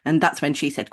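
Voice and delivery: usual speaking voice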